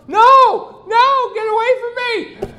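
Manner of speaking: preacher mocking the wife's fake cry for help; mock victim cry. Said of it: "and then say".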